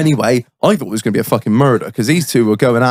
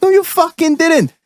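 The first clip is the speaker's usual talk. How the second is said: put-on very high, indignant Welsh accent. Welshman flatly denying the loan to his mate, Welsh accent. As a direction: squawk the denial as a Welshman. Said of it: "I'll do my best Welsh accent".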